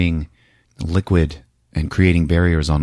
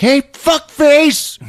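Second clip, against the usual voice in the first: very high, slow, louder mock challenge; high slow taunt; 4 dB louder, much slower than usual. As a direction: drawl the insult up high. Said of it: "He's like".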